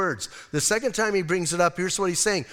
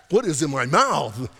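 mock disgust; 4 semitones lower than usual. Tasting Marmite for first time.

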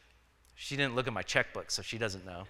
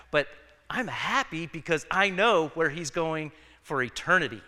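usual speaking voice

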